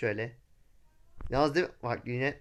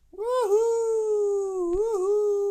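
long high joyful yell, much louder; excited whoop; 5 dB louder, much slower than usual. Real, not acted. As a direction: let out a big thrilled whoop. Joyride whoops. (coşkulu, yüksek sesli bir sevinç çığlığı)

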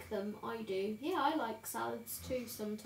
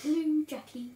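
imitating mother; 3 semitones higher, 7 dB louder than usual. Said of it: "She'll be wanting to come in and go".

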